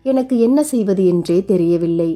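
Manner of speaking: ordinary delivery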